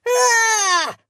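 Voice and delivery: sharp high pained yell; scream of pain. A narrator's reading. Prisoner screaming as the monster grips his hand. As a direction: cry out as the hand is crushed.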